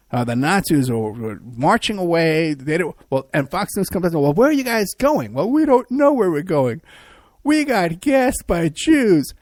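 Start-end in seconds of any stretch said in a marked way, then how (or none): 4.2-5.3 higher, mock-innocent reporter question; sing-song reporter voice, higher. Fox News reporter asks marchers. 5.3-9.3 higher, slow, mocking whine; mock pitiful marcher, higher and slow; whiny Nazi. Retreating Nazis answer the reporter.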